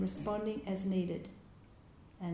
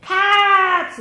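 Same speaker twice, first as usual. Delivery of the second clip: sudden very loud, high shout; shouted Carts; 12 semitones higher, 20 dB louder than usual. Retold or imitated. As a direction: bark it out like an old master. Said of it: "and shouting, going".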